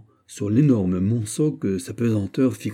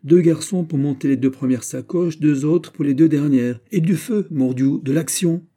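raised, forceful orders rising in urgency; military command tone; 6 semitones higher, 5 dB louder than usual. A narrator's reading. D'Artagnan directing boys to carry the saddlebags, commanding tone. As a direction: command the troops into action. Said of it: "du ton qu'il eût mis à commander une manœuvre".

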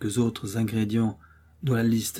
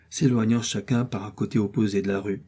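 ordinary delivery

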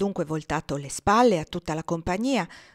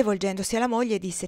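usual speaking voice

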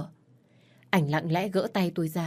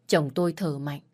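ordinary delivery, nothing marked

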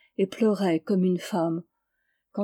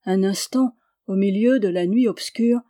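usual speaking voice

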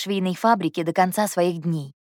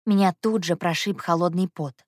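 plain reading voice

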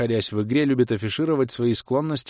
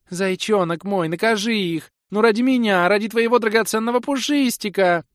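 put-on very high, wheedling girlish voice; pleading sweetheart; 12 semitones higher, 5 dB louder than usual. A narrator's reading. Girl urging her bunny knight to punish them, high pleading sweetheart. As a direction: wheedle in a high, louder squeal.

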